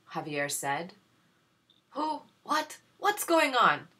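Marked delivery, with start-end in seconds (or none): 1.9-3.9 higher, rising flustered questions; shocked. Acted. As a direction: caught off guard and rattled. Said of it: "Doña Micaela asked".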